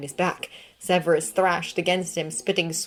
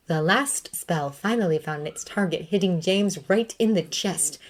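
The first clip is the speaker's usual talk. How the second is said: plain reading voice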